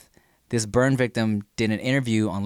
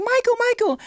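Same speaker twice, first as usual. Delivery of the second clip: high, loud fan screaming a name; 5 dB louder than usual. Retold or imitated. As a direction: shriek it like a fan at the car window. Fan screaming at car window.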